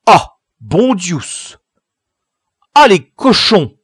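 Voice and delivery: loud, high outraged cry; shouted oath from the lookout; sudden exclamation. A narrator's reading. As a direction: burst out in shocked indignation. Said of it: "s'exclama tout à coup Tougueul".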